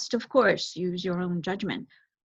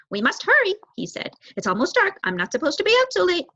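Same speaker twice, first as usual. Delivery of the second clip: high, fast, fretful bird voice; anxious young crow; 10 semitones higher, 5 dB louder, faster than usual. Acted. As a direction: hurry like a nervous youngster. Young crow urges haste.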